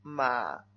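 "Ma" low, dipping; third tone. Shown as a demonstration. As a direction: sink "Ma" down low. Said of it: "Vamos al tercero". ("Ma" en tercer tono, grave)

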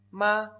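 "ma" held high and level; first tone. Shown as a demonstration. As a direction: keep "ma" on one high note. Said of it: "Primer tono". ("ma" en primer tono, alto y plano)

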